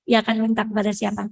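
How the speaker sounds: ordinary delivery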